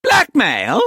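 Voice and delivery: high, loud shrieked echo. A narrator's reading. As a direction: shriek it in outrage. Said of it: "Missus Fotheringill shrieked".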